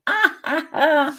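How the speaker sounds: high, short burst of laughter; real high-pitched chuckle. Real, not acted. Laughs at own live stream muddle.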